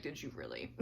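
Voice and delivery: low, quiet "did you really"; mock offended. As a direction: drop the voice in pretend hurt. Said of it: "I was like".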